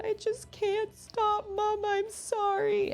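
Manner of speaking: very high, drawn-out mock wail; imitated tearful child. Daughter saying sorry to her mom, imitated tearful wail. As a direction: imitate a little girl bursting into tears. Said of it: "she burst into tears".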